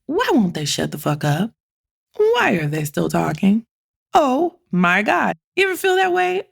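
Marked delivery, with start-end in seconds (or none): none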